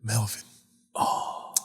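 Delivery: very soft, cold greeting; hushed, withering acknowledgement; disapproving mom. Retold or imitated. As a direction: say the name quietly as an unimpressed mother. Acting out the mom's cold greeting.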